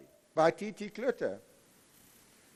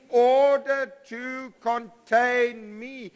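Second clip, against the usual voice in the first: very slow, higher, swelling with fervour; with passion; 5 semitones higher, slower than usual. Shown as a demonstration. Professor showing students how to say it with passion. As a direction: proclaim it slowly like a grand revelation. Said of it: "Now say it with passion".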